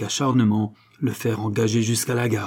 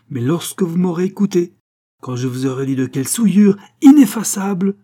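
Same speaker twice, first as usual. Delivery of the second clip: high, loud, emphatic plea; vehement insistence; 8 semitones higher, 8 dB louder than usual. A narrator's reading. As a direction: insist on being heard. Duke heatedly demands a hearing. (insistance véhémente)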